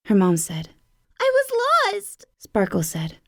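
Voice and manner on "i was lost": much higher, little lost-kitten voice; small kitten voice. A narrator's reading. Lost kitten telling her mom, much higher and small. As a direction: sound small and relieved.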